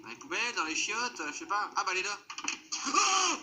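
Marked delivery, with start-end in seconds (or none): 2.9-3.4 sudden high scared cry; startled yelp. Real, not acted. Jump scare in horror game.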